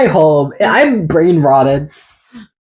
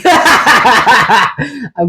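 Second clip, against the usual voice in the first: laughing through the words; 7 semitones higher than usual. Real, not acted. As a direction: giggle while talking slowly. Laughing at a slip-up.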